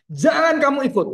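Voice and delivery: high, forceful order falling away; stern command. Acted. Angel giving John an order, sternly commanding. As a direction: order it firmly, pitch leaping up. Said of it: "dan diperintahkan".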